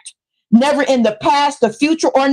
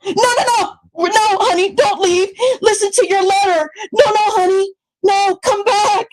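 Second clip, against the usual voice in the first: high, hammed-up begging; high sing-song fake plea; mock pleading; 6 semitones higher than usual. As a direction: pretend to beg her not to leave. Mock begging her to stay.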